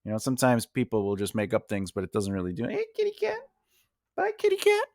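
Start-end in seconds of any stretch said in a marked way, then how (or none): 2.7-4.8 high singsong greeting to a cat; cutesy cat talk. Real, not acted. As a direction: coo up high at the kitty. Talking to the cat.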